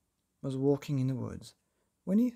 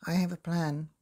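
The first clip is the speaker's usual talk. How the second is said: a sly grin in the voice; grinning; 2 semitones higher, 4 dB louder than usual. A narrator's reading. Painter grinning to his friends, a hint of a grin. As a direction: let the plan smile through. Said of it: "now grinning".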